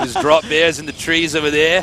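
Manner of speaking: hammed-up Australian accent; put-on Australian twang. Shown as a demonstration. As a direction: play up a mock Aussie accent. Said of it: "can you do an Australian accent?".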